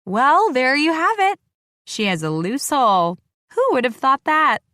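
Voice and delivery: high, loud mocking joke; mock her for the crowd; joking. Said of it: "I joke".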